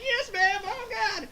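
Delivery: high, frightened acted yelp; obey in a squealing panic; mock panic. Krillin yielding to screaming mother, high mock terror.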